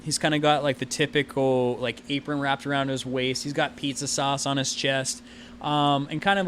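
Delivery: ordinary talk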